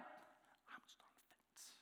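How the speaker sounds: breathy mock whisper, almost silent; whispered aside. Shown as a demonstration. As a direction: whisper the excuse to yourself. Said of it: "I don't even get that one".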